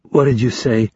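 plain reading voice

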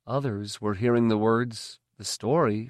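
ordinary delivery, nothing marked

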